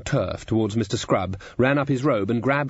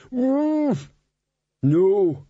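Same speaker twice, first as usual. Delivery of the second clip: dwarf's horrified recoil; 10 semitones higher than usual. A narrator's reading. Glod shudders at Chrysoprase.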